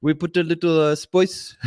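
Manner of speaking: hammed-up, drawn-out French accent; clumsy mock French accent, teasing a producer; shitty French accent. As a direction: imitate a Frenchman with a bad accent. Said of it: "that's my shitty French accent".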